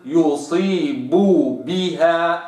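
slow يصيب بها, both ب closed; slow careful recitation; lips fully closed. Shown as a demonstration. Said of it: "ما أقولش".

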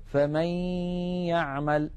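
tajweed example, ghunnah stretched into يعمل; partial idgham recitation. Shown as a demonstration. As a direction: glide the ن of فمن into the ي of يعمل on a long nasal hum. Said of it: "لأن الإدغام هنا ناقص".

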